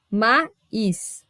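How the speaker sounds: "maíz" as ma, pause, íz; hiatus split apart. Shown as a demonstration. Teacher showing that only a hiatus separates, vowels split apart. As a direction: break "maíz" slowly between the two vowels. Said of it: "Só se separa aquilo que é hiato".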